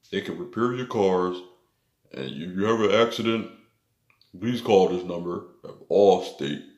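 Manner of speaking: a hint of a TV ad pitchman reading the slogan; Allstate commercial voice. Elder dude selling Allstate on a commercial, mock ad voice. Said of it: "He had that sort of voice or something".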